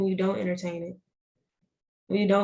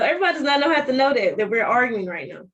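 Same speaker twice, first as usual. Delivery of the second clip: raised, higher and louder, fed up with a partner; exasperated rant; 4 semitones higher, 6 dB louder than usual. Retold or imitated. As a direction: blow up at him over the public posting. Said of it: "I'm like".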